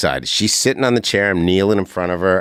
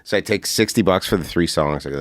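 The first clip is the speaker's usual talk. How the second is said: normal talking voice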